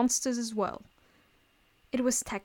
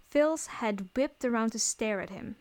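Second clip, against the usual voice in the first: plain reading voice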